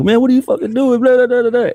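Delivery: high, rowdy teammates' yell; hammed-up shouting from the back seat; mock yelling. Teammates in the minivan yelling at the driver, mock rowdy. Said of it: "like, yelling".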